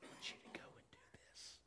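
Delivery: breathy hushed call; God's gentle nudge, whispered. Retold or imitated. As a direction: whisper it without voice. God's quiet voice, retold.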